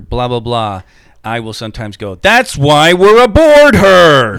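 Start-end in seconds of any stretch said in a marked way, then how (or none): 2.2-4.4 slow, high, hammed-up captain's bellow; theatrical shout. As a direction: shout it slowly, stretching every word. Acts out his Shatner catchphrase.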